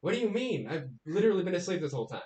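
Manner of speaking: high, puzzled and then fast; confused stranger. Acted. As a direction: protest in a high bewildered voice. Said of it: "he's like".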